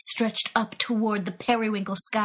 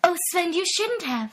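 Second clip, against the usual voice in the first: high, coy feminine voice; coy flirt; 8 semitones higher than usual. Acted. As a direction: flutter it coyly, pitched high. Brenda coyly flattered by Sven.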